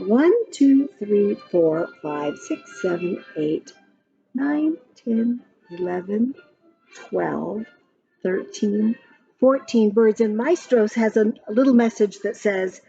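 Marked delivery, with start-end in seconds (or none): none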